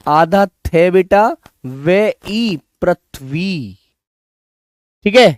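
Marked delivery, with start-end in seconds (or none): 1.6-3.8 पृथ्वी spelled out slowly, the ई drawn long; slow dictation. Shown as a demonstration. Teacher spelling पृथ्वी for pupils, slow and deliberate. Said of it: "रे ऋषि वाला रे आएगा".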